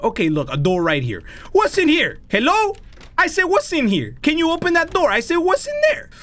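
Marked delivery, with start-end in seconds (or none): 1.5-6.1 calling out. Real, not acted. Calling into a dark room.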